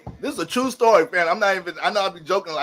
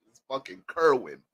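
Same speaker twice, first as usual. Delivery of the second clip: ordinary talk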